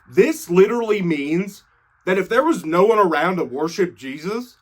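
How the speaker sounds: usual speaking voice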